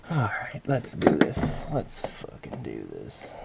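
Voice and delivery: whispered pep talk to oneself; hushed, determined self-talk; psyching oneself up. Real, not acted. Psyching himself up under the car.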